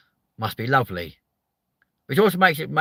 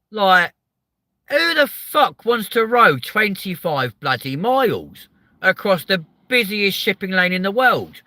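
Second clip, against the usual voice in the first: incredulous rant; 10 semitones higher, 7 dB louder than usual. Real, not acted. Ranting about kayak crossings.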